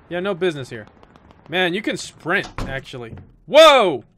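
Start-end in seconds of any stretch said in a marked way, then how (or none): 3.5-4.0 high, loud cry of surprise; startled shout. Real, not acted. Gamer startled in the game, loud surprised shout. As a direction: shout it in sudden alarm.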